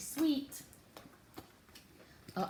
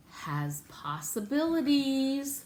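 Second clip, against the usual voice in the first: slow, higher and louder wonder; marvelling; 6 semitones higher, 5 dB louder, slower than usual. Acted. Musing to Nat about the stain, marvelling. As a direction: savour the idea.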